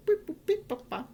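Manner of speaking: high mock device beeps; beeping noises. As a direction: imitate the CGM beeping.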